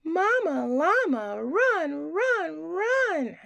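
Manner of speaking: high, loud urgent call. A narrator's reading. Reader urging Mama Llama to hurry, high and loud.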